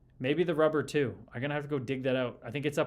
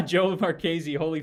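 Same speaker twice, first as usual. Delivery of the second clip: laughing; 3 semitones higher, 7 dB louder than usual. Real, not acted. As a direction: say the name through a laugh, louder. Laughing, greeting the chatter.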